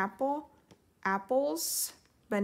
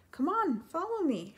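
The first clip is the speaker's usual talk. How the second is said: eager, high-pitched call to follow; excited; 7 semitones higher than usual. Acted. Ariel urging Flounder to follow her, excited. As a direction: Ariel bubbling over to show her friend. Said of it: "she said excitedly".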